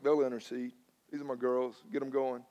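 low, soft retold aside; quiet confiding. Preacher counts on elderly women to pray, quiet confiding. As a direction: keep it down, trusting and private.

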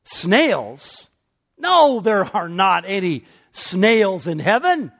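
high, loud, scandalized swan; appalled at the very idea; indignant swan. Acted. Swan indignant about snails.

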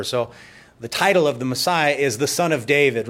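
ordinary delivery

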